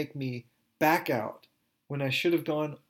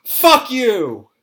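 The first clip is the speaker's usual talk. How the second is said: full-throated scream, much higher and louder; 10 semitones higher, 16 dB louder than usual. Retold or imitated. Two women at the memorial, screaming at the campsites together. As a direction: scream it at the distant campsites with all you've got. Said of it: "we screamed".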